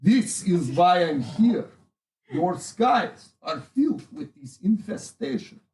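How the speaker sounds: put-on lightly done Russian accent speech; rally the crowd in a Russian accent. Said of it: "I have a Russian accent".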